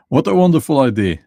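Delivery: high, loud, quick mock delight; mockingly thrilled, on the edge of laughing; sarcastic enthusiasm. Real, not acted. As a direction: gush sarcastically, amused by the absurdity. Sarcastic praise between laughs.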